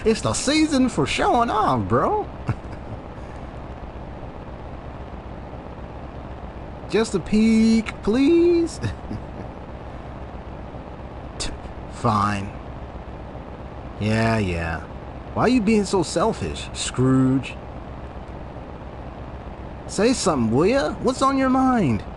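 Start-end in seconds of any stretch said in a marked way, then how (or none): 0.1-8.8 high-pitched pushy buddy voicing a friend; high teasing voice. Player voices a teasing buddy character. 15.3-22.0 high-pitched pushy buddy voicing a friend; high teasing voice. Player voices a teasing buddy character.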